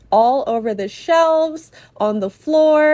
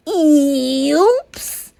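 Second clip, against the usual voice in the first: voicing the thief; 2 semitones higher than usual. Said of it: "they say".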